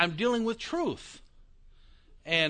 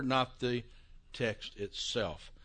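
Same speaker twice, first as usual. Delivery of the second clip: usual speaking voice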